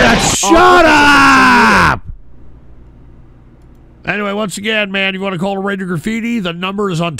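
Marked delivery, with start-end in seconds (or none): 0.0-2.0 slow, loud yell of shut up; furious drawn-out shout. Real, not acted. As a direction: roar it slowly to silence the room. Host yells at noisy callers.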